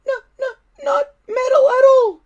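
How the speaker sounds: very high, loud squeaky dragon voice; disappointed little dragon. A narrator's reading. Toothless to Hiccup, squeaky and disappointed. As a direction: let the little dragon sag with letdown. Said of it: "in disappointment".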